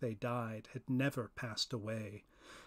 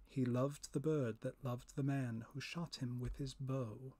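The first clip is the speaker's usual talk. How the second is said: ordinary delivery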